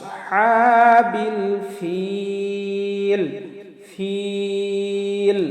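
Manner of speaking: ফিল drawn out three counts, very slow; three-beat stretch. Shown as a demonstration. Teacher chanting ফিল with a three-count stretch. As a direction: hold ফিল long, three beats. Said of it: "তিন আলিফ টান দেব".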